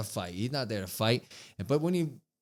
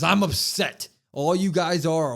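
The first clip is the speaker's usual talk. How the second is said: louder, imitating a heel's rant; mock angry promo; 3 semitones higher, 6 dB louder than usual. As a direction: bark it like a wrestler cutting a promo. Retelling Cena's angry promo.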